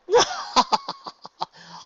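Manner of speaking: very high, unnatural burst of laughter; loud forced laugh. A narrator's reading. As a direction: fake a big laugh far too loudly. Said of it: "Luna laughed, quite loudly and unnaturally".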